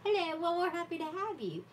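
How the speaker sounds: bubbly high greeting; excited welcome. Real, not acted. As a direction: welcome them with a laugh. Welcoming a new viewer.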